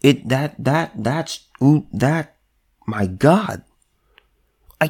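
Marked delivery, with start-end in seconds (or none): none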